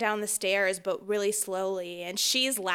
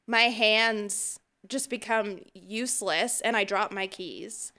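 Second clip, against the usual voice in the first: ordinary talk, nothing marked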